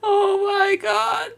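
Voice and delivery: screaming oh my god in disbelief; real shock, shouted high; stunned, shrieking outburst; shocked cry. Real, not acted. Streamer shocked by game scene.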